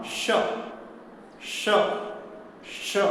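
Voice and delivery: isolated palatal श, a pause after each. Shown as a demonstration. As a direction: drill श with the middle of the tongue at the palate. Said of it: "इसी तरह इसका उच्चारण".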